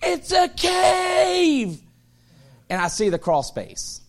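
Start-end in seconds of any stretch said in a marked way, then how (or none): 0.0-1.8 put-on very high, slow, shouted child's cry; imitating a little boy's delighted yell; child screaming. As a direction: squeal it like an excited toddler. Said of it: "he's screaming out".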